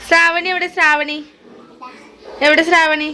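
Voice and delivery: high, loud singsong teasing. Real, not acted. Mother playfully teases about name.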